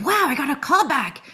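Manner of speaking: thrilled she got the callback; delighted surprise; excited disbelief. Retold or imitated. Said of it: "I was like".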